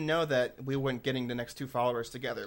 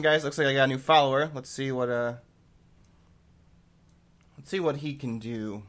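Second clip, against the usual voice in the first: ordinary talk, nothing marked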